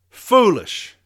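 Foolish. barked out high and loud; loud high scoff at Foolish; loud scornful verdict. Real, not acted. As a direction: spit out Foolish. in disgust. Scorning the memo's author.

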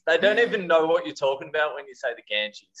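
ordinary talk, nothing marked

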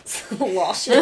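giggling through the word; laughing. Real, not acted. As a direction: laugh it out while saying it.

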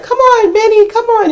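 high, coaxing call to a dog. Retold or imitated. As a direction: coax the runaway dog in a high voice. Calling the runaway dog.

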